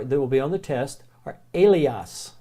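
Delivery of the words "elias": Elias with a long A, ay-LEE-ahs; Greek word. Shown as a demonstration. Teacher to class, modelling Elias with long A. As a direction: open Elias on a long ay sound. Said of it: "Two words I want you to be remembering".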